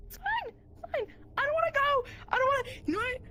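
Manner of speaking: high whiny it's fine, I don't wanna; frustrated whine. Acted. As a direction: whine it like a petulant child.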